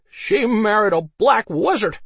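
put-on high, loud, slow drunken voice; drawn-out tipsy exclamation; drunken revelation. A narrator's reading. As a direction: announce it like a gleeful drunk. Drunk Harold reveals the scandal.

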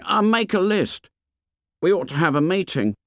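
high-pitched schoolboy character voice; fat boy's voice. A narrator's reading. Fat boy hinting to Ralph, high boyish voice.

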